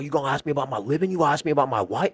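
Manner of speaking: angry homeowner. Retold or imitated. Homeowner ordering the salesman off his yard, fast and angry. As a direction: kick him off the yard. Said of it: "And he just lost it".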